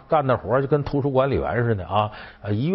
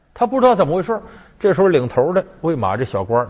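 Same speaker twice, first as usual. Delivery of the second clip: usual speaking voice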